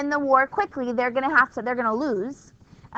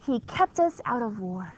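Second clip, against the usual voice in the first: slogan read; 3 semitones lower, 4 dB quieter, slower than usual. Retold or imitated. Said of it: "his campaign slogan in nineteen sixteen is".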